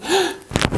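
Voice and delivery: sharp, high gasp for air; snatch a quick breath mid-list. Real, not acted. Reader running out of air on a long list, gasping.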